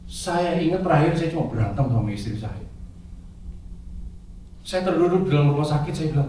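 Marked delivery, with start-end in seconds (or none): none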